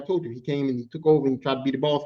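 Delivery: usual speaking voice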